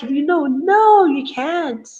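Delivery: high, slow, mild mock imitation of the mother's no; daughter mimicking mom. Daughter copies her mother's firm no, high and slow in light mockery. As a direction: lightly echo mom's refusal back in a child's singsong. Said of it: "And she's kinda like".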